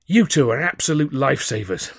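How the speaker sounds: breathless with relief; gasping. A narrator's reading. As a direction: gasp it out, panting. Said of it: "I gasped".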